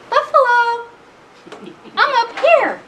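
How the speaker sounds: very high singsong call; tiny mouse calling from up high; teasing high-pitched holler. Acted. Mouse calls from atop buffalo.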